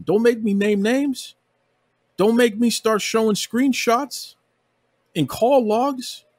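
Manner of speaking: high, slow, pointed warning; stern warning. Real, not acted. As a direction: spell out the threat slowly. Angry warning rant.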